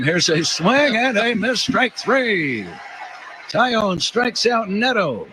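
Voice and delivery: excited strikeout call. Real, not acted. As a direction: lift the voice for the strikeout. Calling a strikeout on radio.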